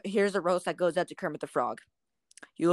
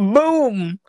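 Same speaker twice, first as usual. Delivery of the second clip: big loud "boom", pitched up; shouted gunshot boom; 4 semitones higher, 7 dB louder than usual. Retold or imitated. Friend voicing the gunshot in the theater story, loud imitation.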